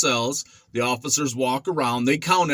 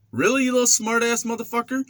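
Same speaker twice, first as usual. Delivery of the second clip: high, raised challenge; angry inmate; 10 semitones higher than usual. Retold or imitated. Mopper threatening the young smart ass, angry. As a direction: throw back the insult, pitched up. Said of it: "dude's like".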